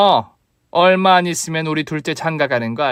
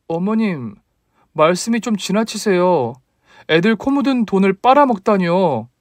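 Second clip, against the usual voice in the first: put-on higher, hurt young wife protesting; higher voice of an offended young wife; daughter-in-law voice; 7 semitones higher than usual. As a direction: throw her insult back at her, higher.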